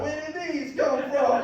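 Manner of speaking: high-pitched, hammed-up Italian accent; Italian accent impression. Comic puzzling over new body, in a put-on Italian accent. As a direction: ask it like a baffled cartoon Italian. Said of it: "Just talk like a fucking Italian".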